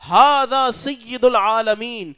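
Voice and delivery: high, loud and slow proclamation; retold shouting monk. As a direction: cry it out like the alarmed old monk. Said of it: "وہ چیخنے لگا اور زور زور سے کہنے لگا".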